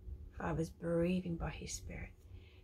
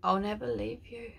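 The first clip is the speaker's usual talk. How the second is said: tender prophetic declaration; 4 semitones higher, 4 dB louder than usual. Acted. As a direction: speak as God comforting his child. Prophetic quote.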